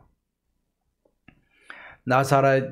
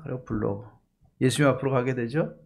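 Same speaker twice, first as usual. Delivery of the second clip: ordinary delivery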